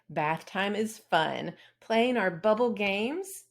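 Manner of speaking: ordinary delivery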